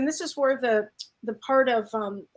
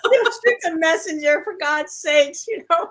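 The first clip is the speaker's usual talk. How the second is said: laughing as she repeats the joke; amused laughing plea; 5 semitones higher than usual. Real, not acted. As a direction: giggle through it.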